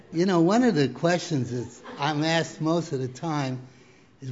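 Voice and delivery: ordinary talk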